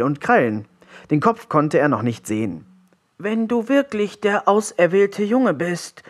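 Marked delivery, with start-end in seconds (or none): 3.2-6.0 high, laboured frog voice; gasping troll frog. A narrator's reading. Ailing frog tests the chosen boy, gasping. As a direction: wheeze it out in a high creature voice. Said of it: "keuchte der Trollfrosch".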